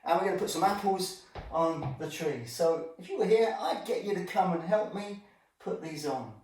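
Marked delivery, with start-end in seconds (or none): none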